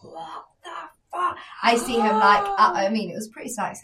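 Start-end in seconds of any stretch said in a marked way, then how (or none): 0.0-1.4 voiceless, very soft mouthing; hushed fury. Retold or imitated. Boyfriend kicking off across club, hushed. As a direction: imitate outrage under the breath.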